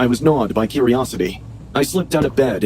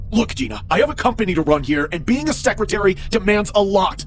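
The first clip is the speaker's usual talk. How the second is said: angry boss; 9 semitones higher than usual. Acted. Manager screaming at fired secretary.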